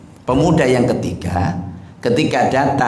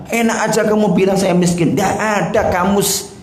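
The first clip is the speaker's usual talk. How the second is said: raised, indignant boast; arrogant retort; 7 semitones higher than usual. Acted. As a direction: scoff at the beggar from up high. Proud rich man rebuffs beggar.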